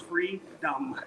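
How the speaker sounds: "free dumb" said as two separate words, free then dumb. Shown as a demonstration. Mocking a bad spelling of the word, split in two. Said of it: "It's two words".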